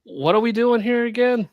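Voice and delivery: high, bewildered what are we doing here; confused mock quote. Mocking Mary Jane's bewilderment, high and confused. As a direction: play a lost character baffled by her own story.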